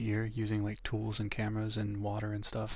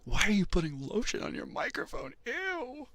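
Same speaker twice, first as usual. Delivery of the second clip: grossed out; 11 semitones higher than usual. Retold or imitated. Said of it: "I was like".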